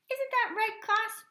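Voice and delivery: very high, loud demand; yelling coach. A narrator's reading. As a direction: call on the whole class to agree. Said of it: "yelled the coach".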